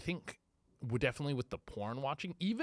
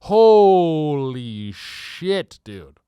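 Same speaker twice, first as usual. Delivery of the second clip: exclaimed; 16 dB louder, much slower than usual. Real, not acted. Exclaims about the memory.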